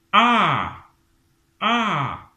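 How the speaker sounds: "A" starting high and sliding down, loud; falling A. Shown as a demonstration. Teacher putting the falling tone on A, high and loud. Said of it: "le ton descendant".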